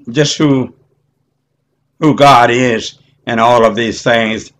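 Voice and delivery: ordinary talk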